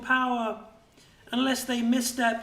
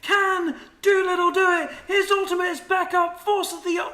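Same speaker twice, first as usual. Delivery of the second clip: fast, raised, urgent fight calling; live play-by-play; 6 semitones higher, 4 dB louder than usual. Real, not acted. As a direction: call the fight as it unfolds. Live team fight commentary.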